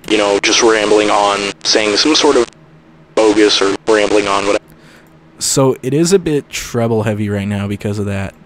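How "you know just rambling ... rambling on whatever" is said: crunchy lo-fi bootleg radio; distorted, crushed old radio; bit-crushed radio. Shown as a demonstration. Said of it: "it sounds like this".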